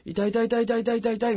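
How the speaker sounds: high, rapid-fire mock "ow ow ow"; imitated pained cries. Mimicking the injured patient brought in next to him, high rapid pained cries. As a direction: imitate someone yelping in pain, fast and high. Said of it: "みたいな声で、もうすごい痛がる人".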